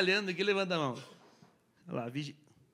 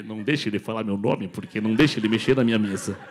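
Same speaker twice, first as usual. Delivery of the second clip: mock voice; 10 semitones lower, 3 dB louder than usual. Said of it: "ele deve, traduzindo".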